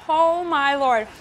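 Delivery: half laugh, half cry from spice; mouth burning from spicy food. Real, not acted. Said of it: "it's so hot".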